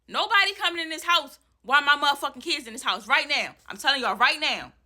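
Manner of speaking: high, heated yell at the landlord; furious yelling. Retold or imitated. Mother blocking the door yells at landlord, furious. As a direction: blast them, you're guarding your kids. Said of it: "I'm like".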